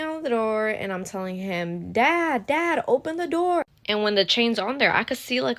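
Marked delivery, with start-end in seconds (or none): 1.9-3.6 calling out to dad. Retold or imitated. Banging on door, calling dad.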